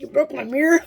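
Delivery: mock outraged question; indignant accusation. Dad accuses her over his broken car mirror, indignant.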